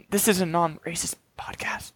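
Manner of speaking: pointed stage whisper. Real, not acted. Host warning cohost mid-skit, in a pointed whisper. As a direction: hiss the reminder under breath. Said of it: "clears throat".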